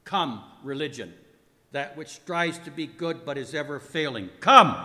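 building from measured command to a shouted "Come!"; grand, swelling theatrical command; commanding summons. A narrator's reading. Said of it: "I commanded those who were present".

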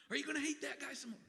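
fast, high, whispered devil taunt; sly devil whisper; Satan goading. Acted. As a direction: hiss it out quickly, egging on. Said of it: "he just wants to say".

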